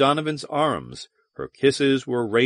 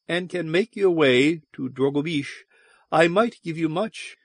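high, lilting alien temptress; Valduma; 5 semitones higher than usual. A narrator's reading. Valduma bargains with Donovan, high and tempting. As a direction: dangle a reward in a higher voice.

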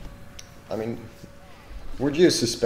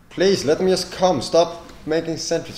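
shouting; 9 semitones higher, 9 dB louder than usual. Real, not acted. Shouting at enemy in game.

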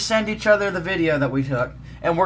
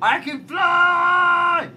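gleeful drawn-out cry; 9 semitones higher, much slower than usual. Pretend. Gleeful while flying in game.

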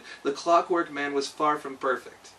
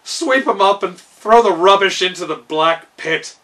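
louder gruff royal command; commanding king; 4 semitones higher, 11 dB louder than usual. Acted. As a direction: bark the order at the servant. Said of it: "he commanded".

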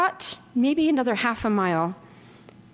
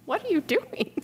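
much higher, baffled question; incredulous; 9 semitones higher than usual. Retold or imitated. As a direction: ask it in disbelief, pitched way up. Man wraps belt round wrist.

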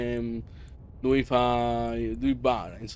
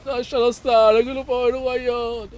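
high, raised plea for mercy; hammed-up outcry; 9 semitones higher, 5 dB louder than usual. Player defending the clone from the boss, hammed-up high outcry. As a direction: cry out to protect a helpless friend.

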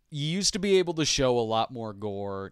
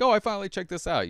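excited viewer; 10 semitones higher, faster than usual. Pretend. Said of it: "I'd get on here and be like".

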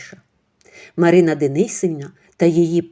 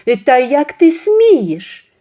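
high, loud, slow outrage; how dare you, drawn out; indignant outrage; 9 semitones higher, 9 dB louder, much slower than usual. Acted. The friend jumping up, outraged at Ksenia. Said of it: "підскочила подруга свекрухи".

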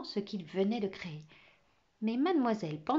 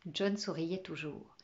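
ordinary delivery

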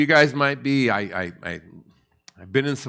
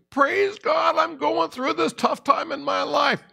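high, bright mock rejoicing in the middle of trouble; mock cheerful praise; slower than usual. Believer praising God in hard times, high mock cheer.

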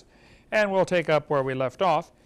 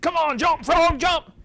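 high, loud pleading shout at the frog; loud, high coaxing scream; coaxing yell; 10 semitones higher, 7 dB louder, slower than usual. Retold or imitated. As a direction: scream at the frog to move, begging it. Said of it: "They yelled and screamed and coaxed".

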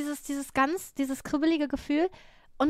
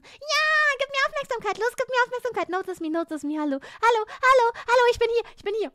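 high, loud, frantic pleading; aufdringliche Katze; 9 semitones higher, 6 dB louder than usual. Acted. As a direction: beg urgently for attention like a needy cat. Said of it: "an der Tür kratzen". (hohes, lautes, drängelndes Katzengequengel)

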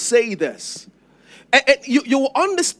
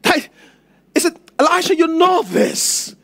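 pleading widow; 6 semitones higher, 3 dB louder than usual. Acted. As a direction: beg the prophet for an answer. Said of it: "This woman said".

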